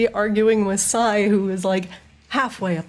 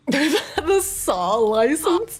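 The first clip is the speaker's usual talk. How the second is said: laughing; much slower than usual. Real, not acted. Game master cracking up at a player's Saw joke, laughing. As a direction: crack up while echoing the question.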